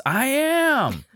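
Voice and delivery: put-on high, offhand kid reply; lightly voiced distracted boy. Son answers mom.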